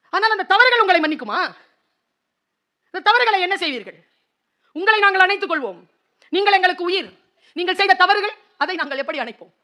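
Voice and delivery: high, loud pulpit appeal; preaching at full force. Acted. Preacher appeals to the children about their mistakes, high and loud.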